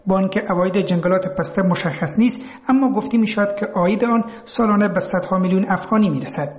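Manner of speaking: ordinary delivery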